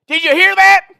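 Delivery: very high, loud shouted question; mock holler. Prank caller shouts the news to his wife, mock holler. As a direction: scream it through to the next room.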